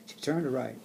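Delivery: ordinary delivery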